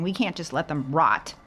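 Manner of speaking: normal talking voice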